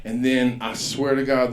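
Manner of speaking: ordinary delivery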